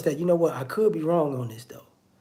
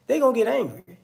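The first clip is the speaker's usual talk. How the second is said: ordinary delivery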